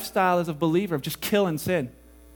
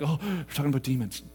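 mock listener; 8 semitones lower, 7 dB quieter than usual. Preacher mocks listener's reaction.

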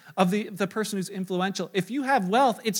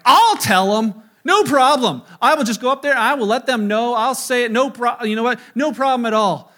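cocky bravado; 2 semitones higher, 8 dB louder than usual. Retold or imitated. Boasting he can handle confrontation.

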